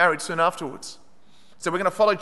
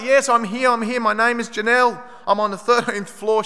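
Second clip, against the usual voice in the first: high, raised retold call for rescue; 5 semitones higher, 3 dB louder than usual. Buried woman answers rescuers from the rubble, calling out. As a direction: call urgently to be found. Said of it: "she'd call out".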